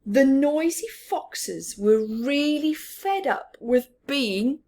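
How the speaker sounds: ordinary delivery, nothing marked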